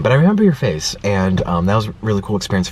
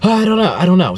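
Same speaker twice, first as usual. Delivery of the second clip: flustered worker; 6 semitones higher than usual. Retold or imitated. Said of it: "she was just like".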